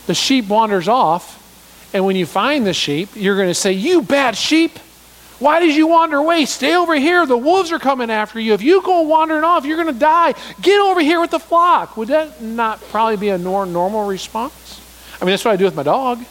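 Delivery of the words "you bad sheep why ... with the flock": scolding shepherd. Retold or imitated. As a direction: bawl out the wandering sheep like a fed-up shepherd. Pastor voices scolding shepherd.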